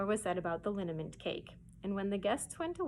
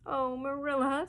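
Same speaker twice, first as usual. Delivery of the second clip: sobbing; 5 semitones higher, 4 dB louder than usual. Acted. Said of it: "sobbed Anne".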